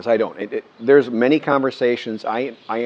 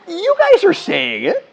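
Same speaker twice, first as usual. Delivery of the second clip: much higher, slow protest of disbelief; indignant protest; 6 dB louder, slower than usual. Retold or imitated. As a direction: squeak it out in disbelief. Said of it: "And I'm like".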